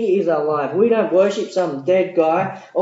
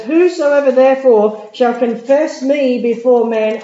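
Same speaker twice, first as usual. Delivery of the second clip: loud, slow scripture proclamation; 8 semitones higher, 9 dB louder than usual. Acted. Preacher booming the verse slowly to the congregation.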